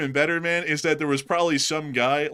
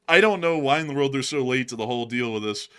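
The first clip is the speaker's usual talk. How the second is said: ordinary delivery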